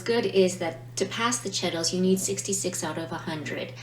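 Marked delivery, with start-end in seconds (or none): none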